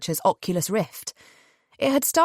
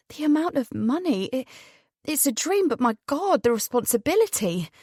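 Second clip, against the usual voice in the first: higher-pitched, faltering awe; overwhelmed woman; 5 semitones higher than usual. Acted. Cora takes in the money and responsibility, overwhelmed. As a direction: break off and restart, voice lifted.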